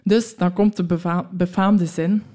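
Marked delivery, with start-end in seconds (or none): none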